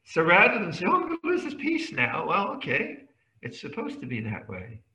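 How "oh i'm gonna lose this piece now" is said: mock fretting. Mocking a fretting meditator.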